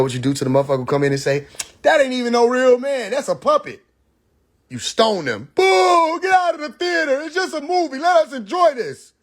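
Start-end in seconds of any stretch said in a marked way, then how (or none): 1.8-3.8 mock know-it-all. Mocking someone exposing the trick. 5.5-9.1 high, hammed-up heckler voice yelling Boo; mock booing crowd. Heckling the man who spoils the movie, in a high mocking voice.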